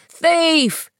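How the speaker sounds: put-on old man crying out, high-pitched and forceful; forceful ringing cry. A narrator's reading. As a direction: cry it out to raise the alarm across a market. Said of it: "with great force, his voice ringing out".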